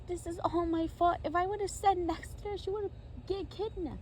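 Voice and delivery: high, upset character voice; high-pitched lament; acted distress. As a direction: blame yourself in a high voice. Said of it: "Smurfette was crying".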